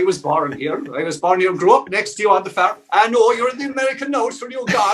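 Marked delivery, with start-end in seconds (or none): 1.5-4.9 high-pitched imitation of scornful locals; mock dismissal. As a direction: pipe up high to write him off, jokingly. Voices locals dismissing him.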